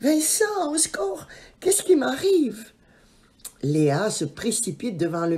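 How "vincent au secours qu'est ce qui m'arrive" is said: panicked cry. A narrator's reading. Léa panics, covered in mushrooms.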